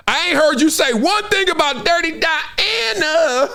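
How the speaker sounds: high-pitched, drawn-out mock indignation; slow, high, incredulous challenge; indignant call-out. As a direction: call her out, stretching the words. Calls out Malika in mock confrontation.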